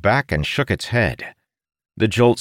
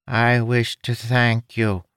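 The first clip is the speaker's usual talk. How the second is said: slow nasal creature voice; pinched, nasal alien speech; 5 semitones higher, slower than usual. Acted. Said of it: "in that nasally voice".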